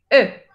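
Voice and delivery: E said with lips rounded as for U; French schwa. Shown as a demonstration. Teacher demonstrating the rounded e to students. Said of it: "boca de U y dijéramos E".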